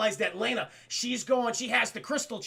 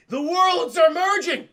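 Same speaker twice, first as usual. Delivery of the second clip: slow, high, booming proclamation; melodramatic cry; 7 semitones higher, 10 dB louder, slower than usual. Retold or imitated. Koopa announcing catastrophe to Mario, melodramatic yell. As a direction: declare doom loudly, stretched out.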